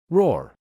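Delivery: a high, loud bear roar; pained animal roar. A narrator's reading. Bear roars in pain.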